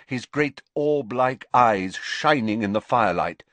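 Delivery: ordinary delivery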